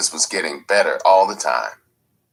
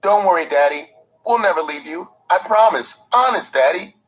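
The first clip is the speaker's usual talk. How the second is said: put-on much higher child's voice, earnest and pleading; little boy's voice; 13 semitones higher, 4 dB louder than usual. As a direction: sound like a ten-year-old clinging to his dad. Ten-year-old boy comforting stepfather.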